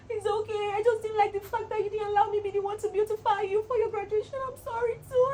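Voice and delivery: high, tearful, voice breaking on sorry too; crying. Real, not acted. Vlogger forgiving her friend, crying and high. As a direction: forgive her through tears. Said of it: "now I'm crying".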